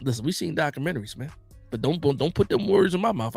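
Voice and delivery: ordinary delivery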